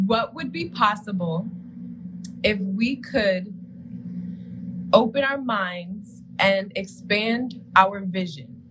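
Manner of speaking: slow, measured question with long pauses. Real, not acted. Host re-asks the question for her slow co-host, slowly and deliberately. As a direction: spell it out phrase by phrase, unhurried.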